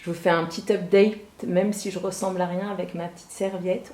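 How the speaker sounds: normal talking voice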